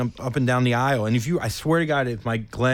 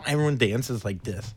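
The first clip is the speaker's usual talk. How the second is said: ordinary delivery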